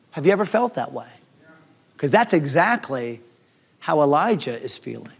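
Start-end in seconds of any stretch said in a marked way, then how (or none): none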